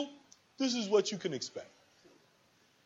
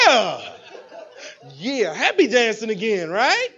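louder, laughing burst of joy; laughing excited yes; 3 semitones higher, 8 dB louder than usual. Retold or imitated. Speaker acts a distributor thrilled the prospect called back, laughing loudly. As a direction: gush with giddy relief. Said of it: "And you go".

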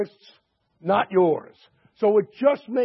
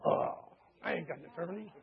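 muttered much softer, deflated, to yourself; meek mutter; 4 semitones lower, 13 dB quieter than usual. Retold or imitated. Customer admitting outside he has no lawyer, sheepish mutter. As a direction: shrink into a sheepish aside after the bold threat. Bluster collapses once outside alone.

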